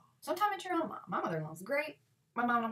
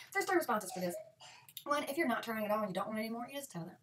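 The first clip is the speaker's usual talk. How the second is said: ordinary talk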